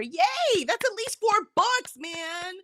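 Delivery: high, loud, drawn-out cheer; real congratulatory whoop; excited cheer. Real, not acted. Cheering a viewer's reading progress.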